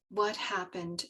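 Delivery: low, soft question. Retold or imitated. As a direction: ask it gently and low. Retold masseuse asks again, softly.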